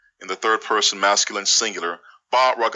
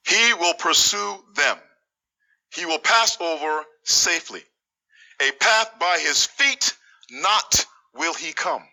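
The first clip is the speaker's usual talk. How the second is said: raised pitch, measured declaiming; high, deliberate reading of a translated verse; scripture recitation; 7 semitones higher than usual. Acted. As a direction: proclaim the verse phrase by phrase.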